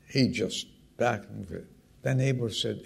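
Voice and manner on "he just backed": ordinary delivery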